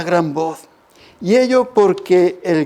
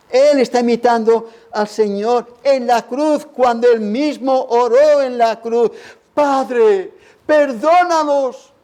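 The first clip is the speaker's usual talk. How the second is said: fervent sermon building higher into a plea; impassioned preaching; 5 semitones higher than usual. Acted. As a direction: pulpit fervour, climbing in pitch. Preaching at full force.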